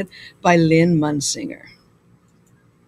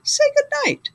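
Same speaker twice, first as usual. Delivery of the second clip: squeaky high. Acted. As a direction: jump way up into a squeaky goodnight. Penguins' proper cheer finish.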